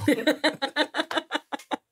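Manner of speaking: high, sustained real laugh; amused laugh. Real, not acted. Laughing at a friend's belated surprise about drugs, high amused laugh.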